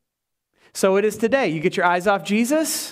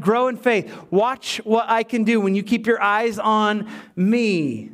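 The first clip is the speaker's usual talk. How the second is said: ordinary talk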